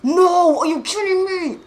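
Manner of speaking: loud, high, disbelieving yell; real frustrated outburst at the screen; exasperated shout. Real, not acted. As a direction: rage at the game for failing again. Failing again in game.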